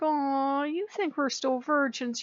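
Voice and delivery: high-pitched mock pity; patronising aww, as to a baby; teasing cooing.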